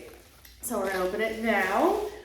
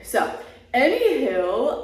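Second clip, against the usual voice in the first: normal talking voice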